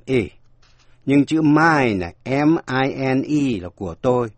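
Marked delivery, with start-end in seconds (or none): 1.4-2.1 "mine" said slowly with the "ai" diphthong; long i sound. Shown as a demonstration. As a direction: draw out "mine" as "main". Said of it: "Đọc với âm I".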